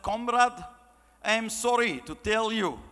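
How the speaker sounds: put-on stagey Russian movie accent. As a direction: put on a James Bond film Russian. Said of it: "if you think that's a good accent".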